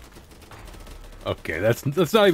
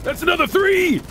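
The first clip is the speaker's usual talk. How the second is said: panicked shout; 13 semitones higher than usual. Real, not acted.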